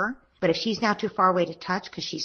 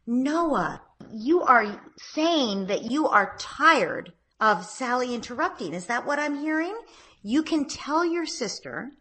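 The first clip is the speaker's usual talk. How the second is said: higher, slow calm parent voice; parent to child; 6 semitones higher, slower than usual. Shown as a demonstration. Mother reflecting her son's feelings, higher slow calm voice. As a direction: reflect it back slowly and gently. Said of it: "So you say".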